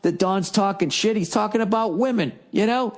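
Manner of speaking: usual speaking voice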